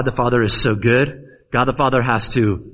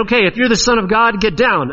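higher-pitched, quick taunt; mock him with a challenge; 8 semitones higher, faster than usual. Onlookers jeering at Jesus on the cross, mocking. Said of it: "he's, he's mocked".

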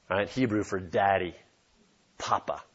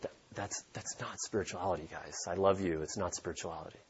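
much softer, almost hushed, kindly correcting; gentle soft rebuke; 3 semitones lower, 14 dB quieter than usual. Retold or imitated. Paul gently telling the Corinthian church, softly and kindly. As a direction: hush down and gently scold them like a loving pastor. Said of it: "Paul's like".

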